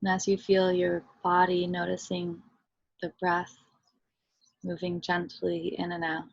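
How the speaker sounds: soft, unhurried meditation voice with long pauses; guided meditation. Real, not acted. Teacher leading listeners into body and breath, guided meditation. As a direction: guide them gently inward, softly and evenly.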